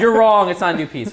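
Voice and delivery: loud, high indignant correction; mock angry commenter. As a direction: shout it like an offended know-it-all commenter. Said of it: "somebody in the comments is like".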